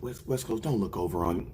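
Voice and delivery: hushed, nervous plea to the boss; kicker scared and hushed. Retold or imitated. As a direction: say it under your breath, afraid of being cut. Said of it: "they have to be like".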